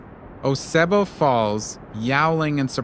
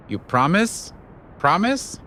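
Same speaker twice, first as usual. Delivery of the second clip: doubtful question; 6 semitones higher, slower than usual. A narrator's reading. Anansi demands a promise.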